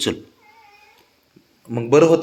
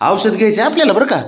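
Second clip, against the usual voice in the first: high, fast coaxing coo; 10 semitones higher, faster than usual. Real, not acted. Owner coaxing pet to take medicine, fast high coo. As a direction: sweet-talk the pet into it.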